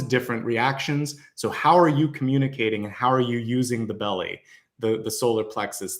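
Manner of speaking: ordinary talk, nothing marked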